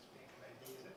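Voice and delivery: soft airy breathy demo. Shown as a demonstration. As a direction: let the air leak through the words. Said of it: "you have a breathy voice".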